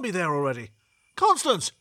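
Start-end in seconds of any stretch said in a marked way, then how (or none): 1.2-1.7 high, raised call of alarm. Acted. Passenger calling to the cab driver, alarmed. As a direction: call out in alarm, pitched right up.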